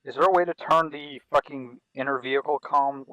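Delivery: loud urgent order; shouting over engine noise. Real, not acted. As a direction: yell over the rotor noise.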